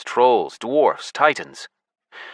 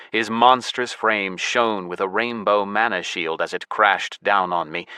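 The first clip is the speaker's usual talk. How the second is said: plain reading voice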